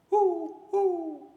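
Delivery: high, loud owl hooting. Acted. Storyteller making the owl's hoots for the children, high and loud. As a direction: hoot like a night owl. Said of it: "The hoot of the owl".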